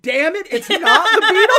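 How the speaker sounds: mocking his complaint back at him, high, loud and laughing; mocking echo. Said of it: "She goes".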